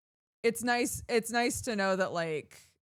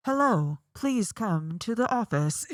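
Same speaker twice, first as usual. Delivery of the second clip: loud, put-on PA voice; booming intercom announcement; 3 semitones higher, 8 dB louder than usual. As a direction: boom it through the whole house. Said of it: "her voice would just boom".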